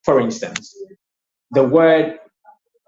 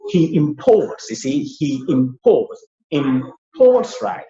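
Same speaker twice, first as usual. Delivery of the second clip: imports with the second syllable PORTS stressed and drawn out; slowly, imPORTS, weight on PORTS; verb stress; slower than usual. Shown as a demonstration. Teacher pronouncing the verb for learners, second-syllable stress on imports. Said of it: "now on the second syllable. I'll pronounce it".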